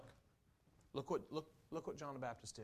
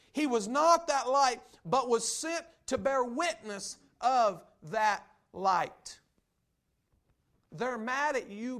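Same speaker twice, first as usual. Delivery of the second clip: rising to a higher, louder, shouting preach; preaching at full force; 7 semitones higher, 9 dB louder than usual. Real, not acted. Preacher drives home his point to the church, building to a shout. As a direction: build the sermon to a roaring climax.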